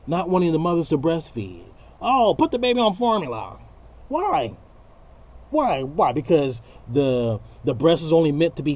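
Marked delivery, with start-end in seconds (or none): 2.0-3.6 high, prissy mock advice; parodying a preachy know-it-all; mocking dismissive voice. Mocking people who discourage breastfeeding. 4.1-6.1 high, pleading repeated why; incredulous high-pitched why; exasperated why. Real, not acted.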